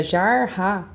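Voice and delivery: jarra said slowly as jar-ra, each R in its own syllable; split syllables. Shown as a demonstration. Teacher shows how jarra sounds when split. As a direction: stretch jarra into two separate beats. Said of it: "pra ficar separadinho".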